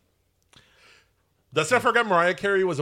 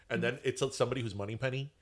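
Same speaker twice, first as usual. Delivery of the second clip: ordinary talk, nothing marked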